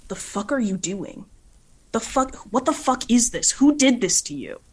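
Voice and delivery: furious, demanding answers at full volume; angry shout. Acted. Luna grabs Aster by the shirt, furious shout. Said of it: "And she says".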